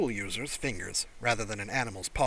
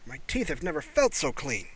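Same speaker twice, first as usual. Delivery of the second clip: amazed; 3 semitones higher, 4 dB louder, slower than usual. Acted. Human marvelling at clean teeth.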